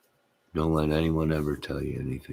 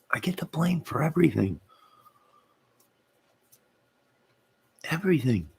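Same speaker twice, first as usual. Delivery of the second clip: high mock whine about the blame; 11 semitones higher than usual. Streamer whining to chat about always being blamed, high mock whine. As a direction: pitch way up, a hammed-up self-pitying whine. Complains he gets all the blame.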